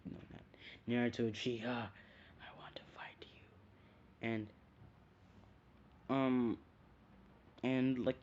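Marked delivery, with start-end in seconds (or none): 1.7-3.4 slow, soft whispered challenge; menacing whisper. Retold or imitated. Gaara challenging Naruto to fight, whispered. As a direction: breathe the challenge out. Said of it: "And he kinda like".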